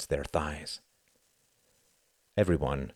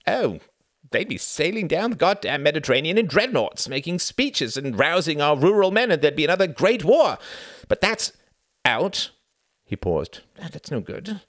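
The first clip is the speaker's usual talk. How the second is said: put-on high, loud, heated old minister; blustering statesman; 11 semitones higher, 6 dB louder than usual. A narrator's reading. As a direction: rant about warships and glory. Sam voiced, blustering.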